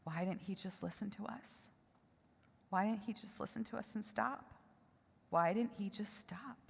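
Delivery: put-on very soft, hushed child's plea; quietly tearful, not sobbing outright; tearful retold plea. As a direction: relay a sobbing question in a fragile near-whisper. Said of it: "in tears, sobbing on a couch".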